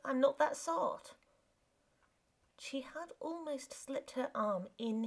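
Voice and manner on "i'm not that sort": rough refusal. Acted. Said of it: "said the man roughly".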